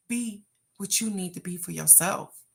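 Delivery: usual speaking voice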